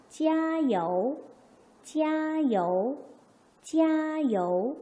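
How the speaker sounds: slow 찌야요, high level 찌야 then falling 요; pronunciation demo. Shown as a demonstration. Teacher leads class in sounding it out, slow tone demo. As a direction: hold 찌야요 high and long, then drop. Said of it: "소리 내봅시다".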